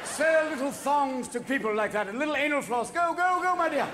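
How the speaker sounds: a dying prince sending his wife off with grand gestures; hammed-up dying Prince Albert. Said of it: "I'm sure Albert said".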